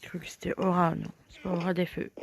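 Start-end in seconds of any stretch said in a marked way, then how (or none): 0.0-0.9 whispering. Real, not acted. Whispered aside.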